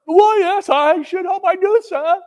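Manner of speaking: high, lightly voiced lad; a hint of a boy's voice; cheeky street boy. A narrator's reading. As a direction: answer like a proud boy. Boy answers.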